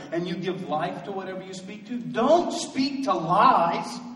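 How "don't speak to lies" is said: high, loud preaching with declarations; full-force preaching. Acted. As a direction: preach it out to the congregation.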